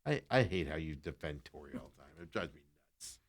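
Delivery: grumbling at the co-host; sulky grumble. Real, not acted. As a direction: mumble it sulkily under your breath. Sulking at co-host.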